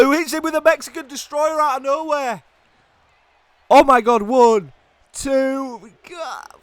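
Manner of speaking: very high, straining outcry; excited screaming call. Real, not acted. Commentator calling a surprise Mexican Destroyer, excited screaming. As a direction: shriek the move, then shout the count.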